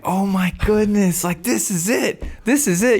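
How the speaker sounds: high, squealing excitement, slowed on "goodness"; overjoyed high-pitched retold delight; thrilled squeal. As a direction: gush like you just found the deal. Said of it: "I'm jumping up and down in my chair".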